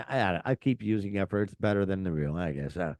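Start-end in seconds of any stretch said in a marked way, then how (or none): none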